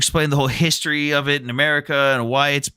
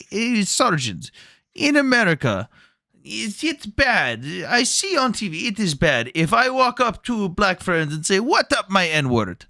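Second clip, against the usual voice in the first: higher, slow mock Ukrainian accent; 6 semitones higher, slower than usual. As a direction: imitate a puzzled Ukrainian soldier asking, higher and slow. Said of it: "they're like".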